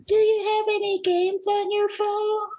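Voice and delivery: put-on high falsetto little girl voice; squeaky little girl begging for help; man doing a woman's voice. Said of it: "That's my girl voice".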